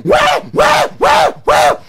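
very high, loud rhythmic wails; repeated yelling. A narrator's reading. Anansi beaten by the whipping cord, yelling. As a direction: yelp again and again in comic agony. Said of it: "And Anansi yelling".

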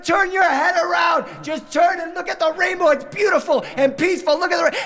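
high, strained dad at wit's end; pleading in a near-shriek; frantic shouting. Acted. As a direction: desperately yell instructions over a crying child. Dad shouts over sobbing daughter.

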